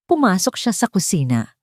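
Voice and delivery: plain reading voice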